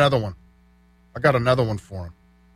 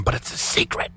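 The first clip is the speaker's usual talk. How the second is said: hushed secretive whisper; playful whisper; 6 dB quieter than usual. Pretend. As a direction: let them in on a secret. Joking about secret art space.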